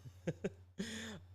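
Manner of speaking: high laugh. Real, not acted. Laughs at a chat comment.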